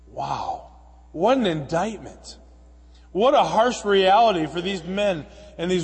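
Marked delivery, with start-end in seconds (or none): none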